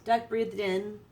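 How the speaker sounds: ordinary delivery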